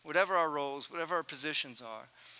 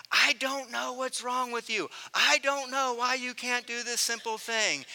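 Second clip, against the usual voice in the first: blowing his lid; 5 semitones higher, 3 dB louder than usual. Retold or imitated. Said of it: "blowing my lid a little bit".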